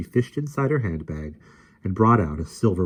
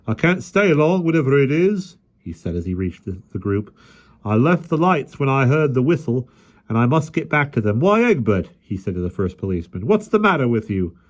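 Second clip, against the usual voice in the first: policeman voice; 7 semitones higher, 6 dB louder than usual. A narrator's reading.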